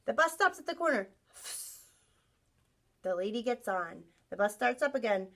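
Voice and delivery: storybook voice for the class; reading to children. Acted. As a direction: read it like a picture book to kids. Reads picture book to class.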